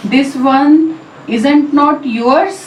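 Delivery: very slow, "yours" stressed with rising tune; a statement meant as a question, lift on "yours". Shown as a demonstration. Teacher showing statement-as-question, rising on yours. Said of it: "yours पे stress, यहाँ पे rising tone".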